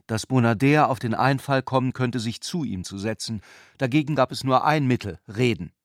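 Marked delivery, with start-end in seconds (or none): none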